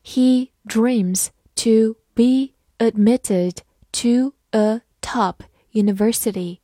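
slow, deliberate reading for learners; slow teacher read-aloud; slowly. Shown as a demonstration. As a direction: drag each word out carefully. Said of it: "好，慢慢来读。".

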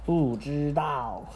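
slow, sulky "don't know"; sulky child. Acted. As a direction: drag it out sullenly.

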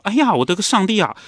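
音调拔高的惊叫“哎呀”; 猛地看见满床血时脱口而出. Retold or imitated. 掀开裙摆看见血. (弟弟掀起裙摆吓了一跳，高声惊呼)

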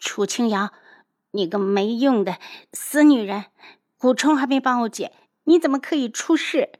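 resentful outburst; bitter, unwilling. Acted. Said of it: "不甘地道".

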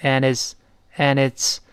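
and it's, said slowly as one linked unit; and it's, the d dropped and the words run together; and it's, a slow linking demo. Shown as a demonstration. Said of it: "And和it's连成".

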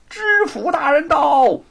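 high, loud announcing cry; high call. A narrator's reading. Herald again announces the prefect's arrival, high loud call. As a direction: call out to halt the execution. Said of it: "有人再一次的高喊".

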